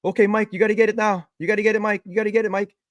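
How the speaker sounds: excited urging. Retold or imitated. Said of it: "he says".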